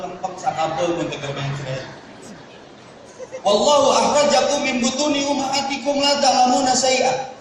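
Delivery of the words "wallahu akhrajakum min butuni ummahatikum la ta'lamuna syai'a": high, ringing Quranic chant; Quran recitation. Acted. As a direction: intone the verse like a qari.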